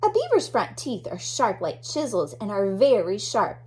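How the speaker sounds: normal talking voice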